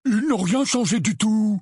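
high, loutish insistence; insistent Dudley. Acted. (démenti buté, voix très aiguë)

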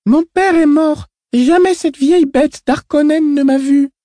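put-on high girl's voice, slow and defiant; a small girl spitting defiance; child voice. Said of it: "dit la fillette".

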